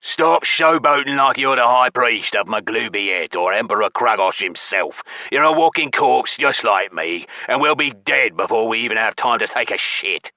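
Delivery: forceful hissed rebuke in creature voice; hissing angry goblin. A narrator's reading. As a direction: spit the words out as a furious goblin. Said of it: "and hissed, spit flying".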